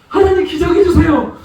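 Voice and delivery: hammed-up whining plea to God; whiny pleading prayer. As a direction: beg for 기저귀 like a sulking child. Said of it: "너는 왜 거기서 울고만 있냐".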